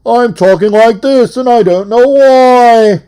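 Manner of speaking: loud, high-pitched TV host bellow; over-the-top shouted celebrity-show host; Robin Leach impersonation. Acted. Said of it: "just did it again".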